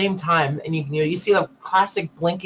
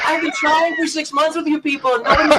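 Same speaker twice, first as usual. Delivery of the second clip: exasperated outburst; 8 semitones higher, 5 dB louder than usual. Retold or imitated. Exasperated retort to the engineer.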